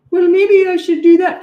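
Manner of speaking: put-on high, loud child voice piping up; child's voice. Child answering parent, high and loud. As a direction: play the kid owning the consequence.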